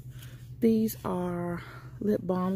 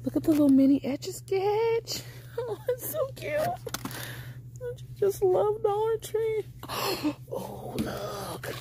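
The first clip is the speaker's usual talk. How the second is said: much higher squeaky gushing with a laugh; excited squeal; 14 semitones higher than usual. Real, not acted. Vlogger squeals to viewers over a mini Etch-a-Sketch. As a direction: squeal over how cute it is.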